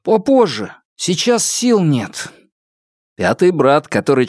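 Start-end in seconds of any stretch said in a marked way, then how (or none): 0.0-2.3 higher-pitched, slow serious reply; serious and unhurried, voiced above narration. A narrator's reading. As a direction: answer seriously, drawing out the last words. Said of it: "серьёзно ответил".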